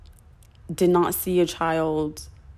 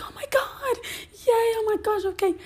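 high whispered squeal of delight; chipper, happy mom; 3 dB quieter than usual. Retold or imitated. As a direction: imitate a giddy mom, breathy and high. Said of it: "chipper, happy".

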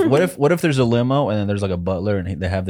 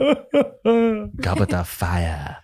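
mock slow drawl; 12 semitones higher, much slower than usual. Mocking Goblet of Fire.

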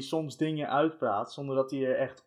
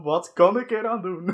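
higher, louder mimicry of a friend; mock quote; 4 semitones higher, 6 dB louder than usual. Teasing a friend with his own words, higher and louder. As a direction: parrot it back, amused and loud. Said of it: "Zo van".

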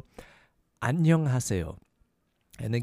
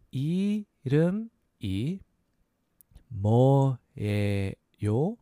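이름이 뭐예요 slowly in separated pieces; slow syllable breakdown; slower than usual. Shown as a demonstration. Teacher breaking down "what's your name", slow syllables. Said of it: "So a little faster".

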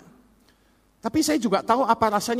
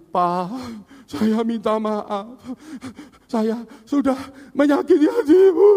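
mock tearful apology; 3 dB louder than usual. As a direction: act out a crying son begging forgiveness. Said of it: "Begitu dia nangis".

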